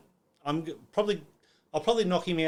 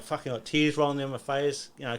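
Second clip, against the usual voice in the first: usual speaking voice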